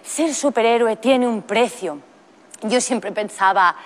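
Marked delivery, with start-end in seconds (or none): 0.0-2.0 slow, mock-solemn movie quote; drawn-out mock-heroic delivery; film superhero line. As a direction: imitate a weighty superhero maxim. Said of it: "en la peli decía aquello de".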